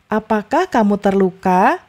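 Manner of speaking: terluka lifts at the end; caring question rising on terluka; rising question tune. Shown as a demonstration. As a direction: ask gently, rising on terluka. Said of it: "Naik lagi ya di akhir".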